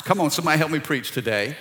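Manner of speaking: ordinary talk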